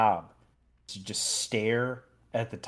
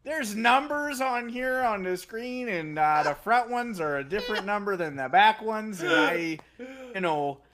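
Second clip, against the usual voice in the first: a confused Minnesotan engineer reading numbers; Minnesotan accent; 9 semitones higher than usual. Pretend. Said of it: "doing a Minnesotan accent".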